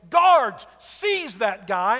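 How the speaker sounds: loud, angry order to the guards; a king shouting for his guards; 1 shouted command. Acted. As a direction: bark it at the guards like a furious king.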